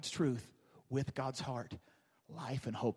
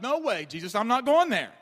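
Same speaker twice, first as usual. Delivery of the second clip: mock refusal; 5 semitones higher, 10 dB louder than usual. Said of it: "Moses was like".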